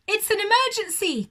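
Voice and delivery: high, loud, fast cry; shouting. Acted. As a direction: make it sound urgent and alarmed. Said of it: "cried Aussie".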